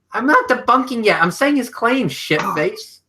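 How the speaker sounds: high-pitched whiny protest; whiny complaining. Real, not acted. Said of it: "listen to you bitching".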